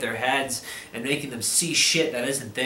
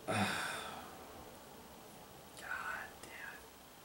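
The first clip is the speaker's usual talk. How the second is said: whispered curse; 6 semitones lower, 22 dB quieter than usual. Real, not acted. Whispered curse after sigh.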